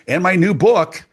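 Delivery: big radio announcer plug. Shown as a demonstration. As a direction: sell it like a radio ad. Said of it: "Sounded very radio".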